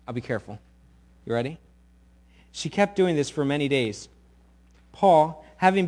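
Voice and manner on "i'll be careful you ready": normal talking voice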